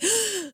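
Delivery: imitated sobbing gasps for breath; hyperventilating gasps. Woman shows how she cried on the phone, hyperventilating gasps. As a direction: act out gulping air while crying. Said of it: "I was, like, hyperventilating".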